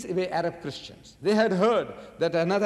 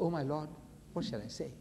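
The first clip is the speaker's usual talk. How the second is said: hushed, inward plea to God; silent prayer; 10 dB quieter than usual. Retold or imitated. Prophet privately asking his Lord, hushed. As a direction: say it under your breath, privately. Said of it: "Nobody heard that".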